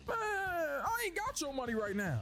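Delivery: high, slow put-on voice; imitating a pleading man. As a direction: whine out the excuse. Voicing the other man.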